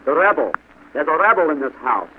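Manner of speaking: loud accusation; sergeant's bellow. A narrator's reading. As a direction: bellow out the alarm. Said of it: "bellowed the sergeant".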